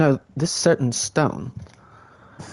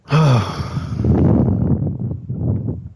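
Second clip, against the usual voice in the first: long sigh; 3 semitones higher than usual. Real, not acted. Long sigh while playing.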